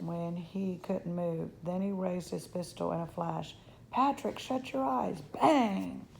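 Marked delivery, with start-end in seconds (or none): 3.9-5.2 high, urgent cry of warning. Acted. Boy warning his friend of the pistol, urgent. 5.2-6.1 loud, sudden bang; gunshot exclamation. A narrator's reading. Narrator as the cowboy fires, explosive.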